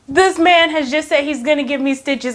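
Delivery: plain reading voice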